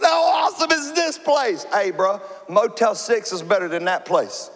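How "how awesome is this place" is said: high, marveling awe; amazed Jacob. Retold or imitated. Jacob waking on a rock, marveling high at the place. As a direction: wonder at it wide-eyed. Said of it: "now he's waking up going".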